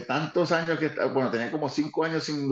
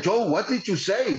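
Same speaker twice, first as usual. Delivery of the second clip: incredulous; 6 semitones higher, slower than usual. Retold or imitated. Dick Murray quoted, incredulous.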